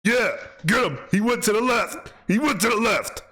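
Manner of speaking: high, excited calling out; urging on a chase. Pretend. Host cheering on police chasing a runner, excited high calls. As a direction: holler directions to the pursuers.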